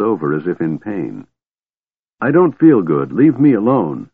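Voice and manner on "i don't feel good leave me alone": put-on a louder, higher childish whine; a hint of a whining child; childlike mumble. A narrator's reading. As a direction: sulk like a sick child. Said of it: "he mumbled like a child".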